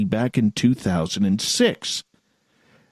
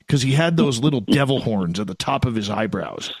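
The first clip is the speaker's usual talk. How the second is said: ordinary talk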